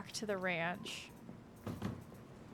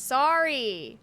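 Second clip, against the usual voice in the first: much louder, pitched-up apology; loud sorry; 4 semitones higher, 12 dB louder than usual. Real, not acted. Streamer apologizing to chat, loud yelp.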